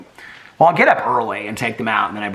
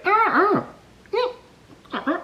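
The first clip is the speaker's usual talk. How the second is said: tiny, very high, breathy yelps; imitating puppy yips; slower than usual. Owner repeats the puppy noise to her dogs, high yips. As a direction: squeak them out like a newborn pup. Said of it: "Well, they do it, too".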